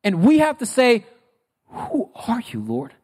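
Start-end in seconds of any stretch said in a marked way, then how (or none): 1.7-2.9 hushed, softer plea to God; quiet, stunned wondering; humbled, bewildered question. Acted. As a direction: ask it softly, falling away on "Lord". Humbled believer questioning God when knocked down.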